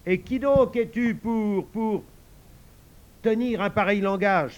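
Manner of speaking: high loud line with a halt; boy reciting his part from memory; stumbling recital. Acted. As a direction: forget the line mid-challenge. Joe stumbles, Tom prompts.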